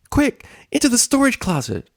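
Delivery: high, quick command; urgent pony. Acted. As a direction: hurry them in. Pony urging hiding.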